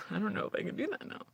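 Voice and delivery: very quiet, rushed self-doubt; barely audible, hurried admission; doubtful mutter. Retold or imitated. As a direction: mutter it quickly under your breath. Self-doubt about acting, voiced as quote.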